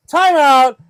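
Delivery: loud, drawn-out call; loud mock interruption; calling time out. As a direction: shout to halt the action. Acting out stopping the action.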